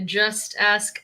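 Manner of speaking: ordinary talk, nothing marked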